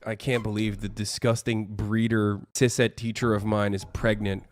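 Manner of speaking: low, soft mock-disgusted voice; mocking imitation. Mimicking opponents appalled by a pregnant teacher, low mocking voice. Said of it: "That's what you sound like".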